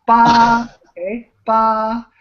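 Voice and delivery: "ba" held long and level; first tone. Shown as a demonstration. Teacher modelling first tone, "ba" level and drawn out. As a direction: keep "ba" on one steady, sustained pitch. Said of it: "First tone".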